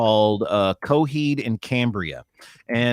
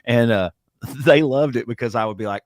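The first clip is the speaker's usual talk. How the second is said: ordinary talk, nothing marked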